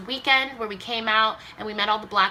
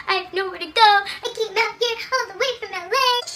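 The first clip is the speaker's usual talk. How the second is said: high, wailing sob-voice; retold bawling; 13 semitones higher, 4 dB louder than usual. Telling the school office she has nowhere to go, wailing. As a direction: wail it out like you're breaking down in tears. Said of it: "started bawling in her office".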